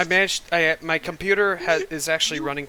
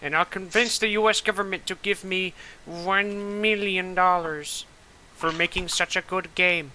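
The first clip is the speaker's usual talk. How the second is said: grandiose villain; 5 semitones higher than usual. Pretend. Pompous mock villain speech.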